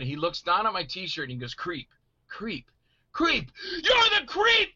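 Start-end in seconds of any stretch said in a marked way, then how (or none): 1.5-3.5 dawning recognition. Retold or imitated. Store clerk reading a T-shirt, recognition building. 3.8-4.7 very high, hammed-up fan yell; mock excited shout. Store clerk recognizing the movie star, wildly excited.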